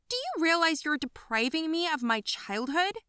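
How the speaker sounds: jealous daughter confronting parents; accusing. Acted. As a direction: throw it at them like a charge.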